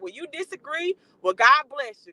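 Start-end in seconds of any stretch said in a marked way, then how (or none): 1.2-2.1 God bless you, belted out louder; loud sarcastic blessing. Real, not acted. Blessing a viewer who disagrees, loudly mock-sweet.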